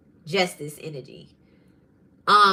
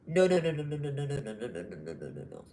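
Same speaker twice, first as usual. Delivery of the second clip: no after no, sinking lower and softer until barely there; fading repeated no; 5 semitones lower, 9 dB quieter, faster than usual. Retold or imitated. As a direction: let each no drop away into nothing.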